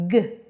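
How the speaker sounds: G as a short "guh", not the letter name; brief isolated hard "guh" for G; hard G sound. Shown as a demonstration. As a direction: say G as the first sound of goat. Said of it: "Letter G makes the".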